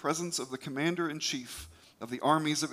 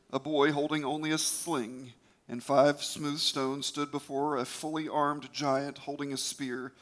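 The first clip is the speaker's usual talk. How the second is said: ordinary delivery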